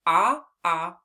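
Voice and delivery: "ah" sounded for octopus; short o sound. Shown as a demonstration. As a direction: isolate the vowel "ah". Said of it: "the beginning of the word octopus".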